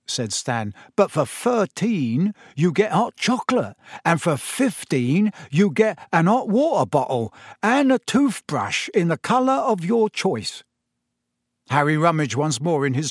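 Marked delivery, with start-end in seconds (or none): 1.0-10.6 higher-pitched conductor reeling off a price list; chatty conductor's sales patter, pitched up; young bus conductor. A narrator's reading.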